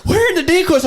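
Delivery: high, loud question through a laugh; confused shout. Retold or imitated. Hunters asking where to look, confused shout. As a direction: yell back baffled. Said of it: "they're like".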